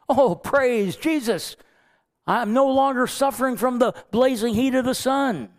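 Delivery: overjoyed relief, played big; ecstatic Jonah. Jonah thrilled by the shading vine, high and gushing. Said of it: "he's ecstatic".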